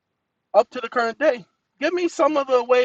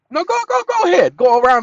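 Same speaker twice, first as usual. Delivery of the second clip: much higher and louder, then fast; excited urging; 9 semitones higher, 9 dB louder, faster than usual. Real, not acted. Man urging the assistant to search, excited. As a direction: egg the machine on, thrilled.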